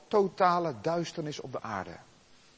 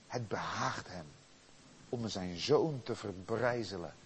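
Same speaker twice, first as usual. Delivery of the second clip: awed hush; 4 semitones lower, 5 dB quieter than usual. Acted. Preacher on God crushing his son, awed and quiet. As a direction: drop to a hush of awe.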